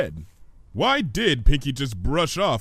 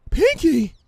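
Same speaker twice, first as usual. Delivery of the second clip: loud, higher cry of the name; startled outcry; 8 dB louder than usual. Acted. Fluttershy shocked at Pinky's sudden appearance, loud. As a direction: gasp out her name.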